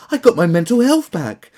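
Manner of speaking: high, loud gloating parody; mocking gush. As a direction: sing it out like a bragging celebrity. Parody versus his own plain read.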